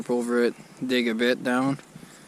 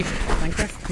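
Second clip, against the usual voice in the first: high questioning; 6 semitones higher, slower than usual. Acted. Repeated joke, higher.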